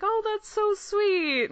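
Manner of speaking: high, slow imitated coo; touched. Director touched by a fan's thanks, cooing. Retelling his touched response to praise.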